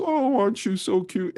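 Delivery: put-on high, sing-song baby talk. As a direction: coo like talking to a baby. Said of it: "you start talking in a baby voice".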